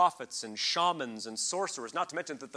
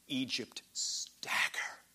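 whisper; 3 dB quieter, much slower than usual. Acted. Verse ending in a whisper.

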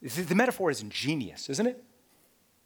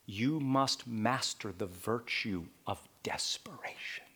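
whisper; 6 semitones lower, 6 dB quieter than usual. Real, not acted. Whispered sermon point.

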